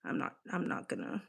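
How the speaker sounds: unvoiced muttered aside; a hushed, whispered aside. Real, not acted. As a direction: say it under your breath.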